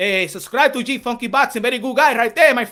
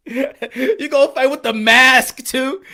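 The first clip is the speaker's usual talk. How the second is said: slower and louder through laughter; laughing; 4 semitones higher, 10 dB louder, slower than usual. Real, not acted. Streamer mocking masked fighters, laughing repeat. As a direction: laugh while asking it again.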